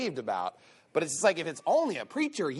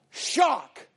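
sarcastic gasp, then a raised mock yell of shock; 8 semitones higher, 4 dB louder than usual. Preacher mocks the churchgoer's news with fake shock. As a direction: fake astonishment, hammed up.

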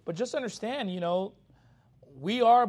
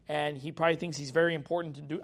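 ordinary talk, nothing marked

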